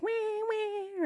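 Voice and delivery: high squeaky mock meows; cat meow imitation. Voicing her cat Gia's meows. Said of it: "she's like".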